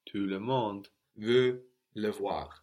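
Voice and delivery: "veut" said without its final T; don't sound the T of "veut"; model with silent T. Shown as a demonstration. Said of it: "we don't hear that".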